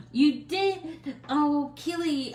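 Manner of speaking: gasp, then a high, loud, delighted reply; light up at the viewer's news; thrilled gasp to a viewer; excited gasp. Real, not acted. Excited reaction to viewer comment.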